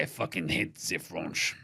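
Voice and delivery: put-on low, soft, slow French accent; botched accent that comes out French. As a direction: slip into a heavy French accent. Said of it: "that was a French accent".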